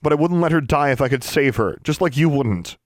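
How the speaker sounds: high, loud, impassioned begging; desperate pleading. Acted. Girl begging her estranged sister to save a friend, desperate pleading. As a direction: plead urgently, pitched high and pushed hard.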